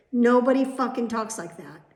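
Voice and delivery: loud, high, annoyed; emphatic fed-up outburst; worked up. Real, not acted. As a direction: insist loudly, really irritated. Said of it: "can you see how worked up I am".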